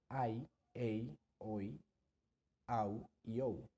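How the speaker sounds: ai, ei, oi, au, ou glided slowly, each one separate; diphthongs demonstrated. Shown as a demonstration. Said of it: "cinco diptongos". (diptongos ai, ei, oi, au, ou pronunciados despacio)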